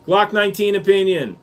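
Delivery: ordinary talk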